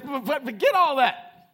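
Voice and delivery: high, loud preacher's exclamation; shouted command. Acted. Preacher voicing God's word to the church, shouted command. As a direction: blast it out and stretch the last words.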